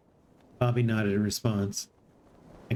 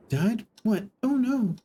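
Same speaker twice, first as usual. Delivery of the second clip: shocked; 11 semitones higher, slower than usual. Acted. Shocked guests react.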